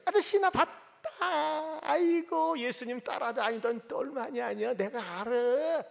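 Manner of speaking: put-on high, slow teasing girl's voice; a servant girl drawling I saw you; girl taunting Peter by the fire, high and slow; girl accusing. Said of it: "어떤 여자애가 가지고".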